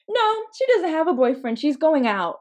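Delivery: put-on high, loud childlike insistence; strong conviction. Child correcting mom about the doll, high firm conviction. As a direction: flatly contradict in an emphatic kid voice. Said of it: "such strong conviction".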